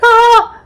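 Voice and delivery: high, loud mock shriek; pretending to wait for her hookup, a loud high shriek. Mock shriek while waiting.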